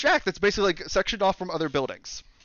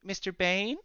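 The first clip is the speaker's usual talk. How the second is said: soft, timid question rising at the end; sheepish kid; 3 semitones higher, 6 dB quieter than usual. Acted. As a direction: ask it shyly, like a little kid. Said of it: "sheepishly holding a piece of paper".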